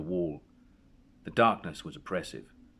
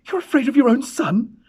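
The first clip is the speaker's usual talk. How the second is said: much higher, much louder scornful cry; angry cry; 11 dB louder than usual. A narrator's reading. Mother scorning her frightened husband, shouting. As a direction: hurl the accusation at him. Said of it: "she cried".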